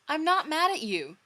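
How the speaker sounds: high, loud, fierce insistence; fierce, loud protest from a hurt friend; fierce reply. Acted. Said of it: "Joey replied fiercely".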